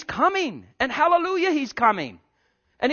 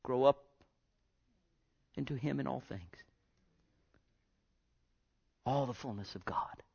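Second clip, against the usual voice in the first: hushed reverent whisper; 9 semitones lower, 15 dB quieter, faster than usual. Real, not acted. Preacher hushes the congregation before God, whispering. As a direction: drop to a near whisper, much softer. Hushed prayerful close to prayer.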